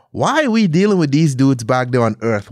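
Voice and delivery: usual speaking voice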